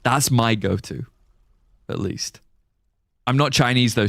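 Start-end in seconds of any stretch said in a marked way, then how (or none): none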